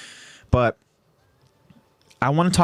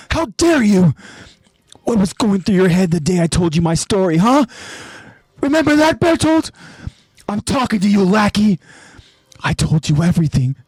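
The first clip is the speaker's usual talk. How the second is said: furious accusation; 7 semitones higher than usual. Acted. Aaron confronting Bertolt, higher and furious. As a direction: throw the betrayal back in his face. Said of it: "with this voice acting".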